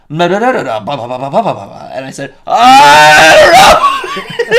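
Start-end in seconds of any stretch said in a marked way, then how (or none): 0.1-1.9 gabbled nonsense standing in for French; mock rapid French. Waiter's French retold as gibberish. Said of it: "and it was just". 2.5-4.6 very high, loud, stretched laughing shout; panicked shout. Retold or imitated. Diner retells panicking at the waiter with a high shout. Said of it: "I just freaked out".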